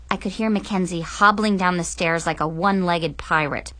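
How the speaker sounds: ordinary delivery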